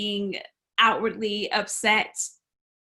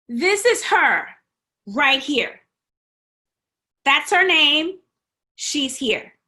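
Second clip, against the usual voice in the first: higher and louder, firmly pointing her out; insistent call; 5 semitones higher, 4 dB louder than usual. Retold or imitated. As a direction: speak up for her to the whole room. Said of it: "she tells the professor".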